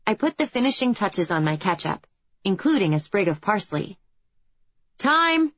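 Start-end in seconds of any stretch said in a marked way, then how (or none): 5.0-5.5 sharp shouted call, higher; shouting. A narrator's reading. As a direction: call time like a stage manager. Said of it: "Jill shouts".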